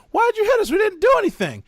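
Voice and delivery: put-on high, loud kid voice of protest. Kids in the car asking why they were hit, high and loud. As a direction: squeal it like an offended child.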